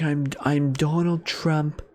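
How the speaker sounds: Donald Trump. Shown as a demonstration. Correcting the rapper's Trump, slow understated impression. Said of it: "actual deeper voice than that".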